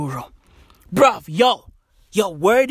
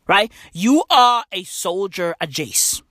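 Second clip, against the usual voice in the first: ordinary talk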